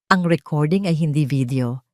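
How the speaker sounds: ordinary delivery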